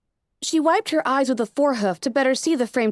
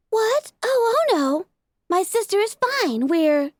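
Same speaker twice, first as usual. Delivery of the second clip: put-on high young filly voice; bright little sister voice; Sweetie Belle; 9 semitones higher than usual. A narrator's reading. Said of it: "the voice of Sweetie Belle".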